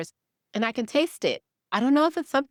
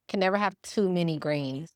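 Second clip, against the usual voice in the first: ordinary talk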